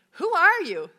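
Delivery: high, loud startled cry; jolted awake in the dark, demanding. A narrator's reading. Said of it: "the man was startled".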